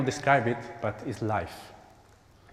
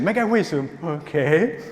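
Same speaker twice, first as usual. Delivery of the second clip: mock adult wish; 4 semitones higher, 5 dB louder, slower than usual. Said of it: "imagine doing that situation".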